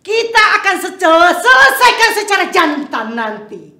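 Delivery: shouting a duel challenge at his rival; challenging shout. Acted. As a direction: throw down the gauntlet, loud.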